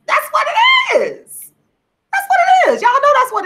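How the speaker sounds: put-on high squeaky Valley girl voice. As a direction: pitch it way up, Valley girl style. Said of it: "a Valley girl".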